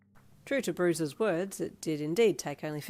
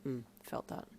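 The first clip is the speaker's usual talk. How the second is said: low, soft grunt of sympathy; 5 semitones lower, 9 dB quieter than usual. A narrator's reading. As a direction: grunt it quietly, lower in pitch. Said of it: "he grunted as he stood up".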